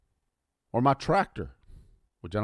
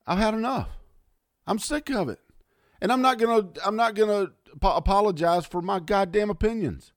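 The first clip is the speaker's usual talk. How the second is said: angry outburst; 9 semitones higher than usual. Real, not acted. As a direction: voice jumps high, fed up and heated. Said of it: "but I'm mad".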